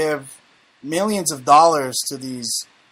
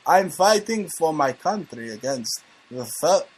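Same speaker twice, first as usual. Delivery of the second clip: put-on Russian accent; 4 dB quieter than usual. Host quotes a biker fighting against America, Russian accent. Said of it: "do it in a Russian accent".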